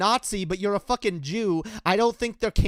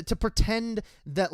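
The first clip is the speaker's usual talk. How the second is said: ordinary talk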